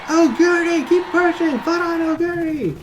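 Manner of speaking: high shouted cheer. Acted. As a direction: cheer her on from the stands. Cheering runner from stands.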